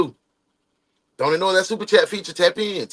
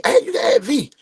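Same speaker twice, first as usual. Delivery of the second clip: calling out; 12 semitones higher than usual. Retold or imitated. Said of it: "When them folk used to jump on me".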